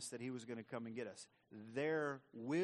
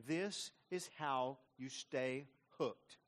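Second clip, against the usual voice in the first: very slow, weighty delivery; emphatic slow; much slower than usual. Real, not acted. Preacher driving home the point, very slow. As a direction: land each word slowly. Preacher repeats key line.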